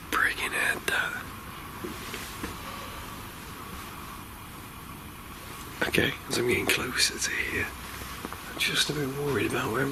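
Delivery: hushed whisper; real whisper. Real, not acted. Ghost hunter whispering to viewers in the dark. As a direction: keep it under your breath, spooked.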